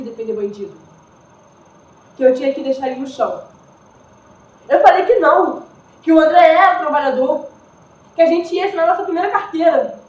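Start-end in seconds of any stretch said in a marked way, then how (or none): 4.7-10.0 louder and higher, urgent protest; telling the police he was a worker, in raised desperate pleading. Acted. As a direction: plead for his life, voice rising. Pleading with police over André.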